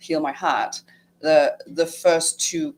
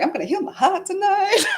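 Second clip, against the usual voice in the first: mock-confident quote, laughing and much higher; self-mocking laughter; 5 dB louder than usual. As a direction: laugh at her past self. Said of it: "It's like".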